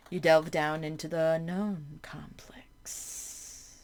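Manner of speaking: slow eerie whisper; creeping dread; ominous whisper. Acted. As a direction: breathe it like a secret in the dark. Descending into the unknown.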